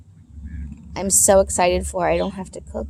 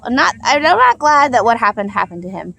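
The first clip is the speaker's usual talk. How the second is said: usual speaking voice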